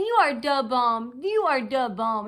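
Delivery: mimicking someone else's line, slowly; meniru ucapan orang lain. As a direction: draw out the mocking imitation. Mimics what a woman was saying.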